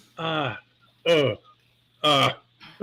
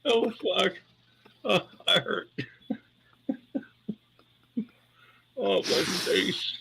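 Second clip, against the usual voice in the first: laughed out; 5 semitones higher, 7 dB quieter, slower than usual. Real, not acted. Grandpa recovering from laughing fit.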